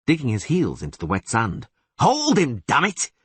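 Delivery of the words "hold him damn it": straining to hold. Acted. Straining to land the catfish.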